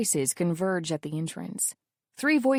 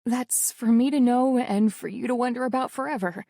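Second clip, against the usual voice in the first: ordinary delivery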